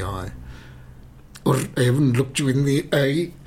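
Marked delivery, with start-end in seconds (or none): 1.4-3.3 louder, higher attempt at a Scottish accent. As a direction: have a shaky go at Scottish, raised voice. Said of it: "I can't do a Scottish accent".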